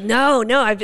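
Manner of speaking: ordinary delivery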